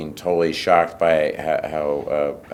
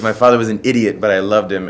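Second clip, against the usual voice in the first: higher, louder deadpan line; son in the film; 5 semitones higher, 10 dB louder than usual. Retold or imitated. Son remembers his father, loud and a bit higher. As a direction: deliver the punchline with loud feeling. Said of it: "And he goes".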